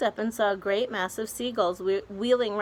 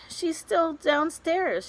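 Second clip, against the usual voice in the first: put-on old grasshopper; 10 semitones higher, slower than usual. Grasshopper voiced high and slow.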